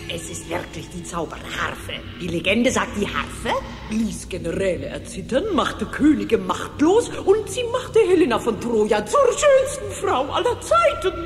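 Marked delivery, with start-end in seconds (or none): 5.5-11.1 building to a high, gleeful climax; rapturous excitement. Acted. Witch reading the harp's legend, rising greedy rapture. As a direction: get carried away, pitch climbing.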